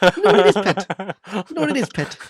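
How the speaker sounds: very high, exaggerated Geordie; Geordie accent. Shown as a demonstration. Showing off an accent for co-host, high Geordie. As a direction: squeak it out in hammed-up Geordie. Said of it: "I wanna hear your Geordie accent".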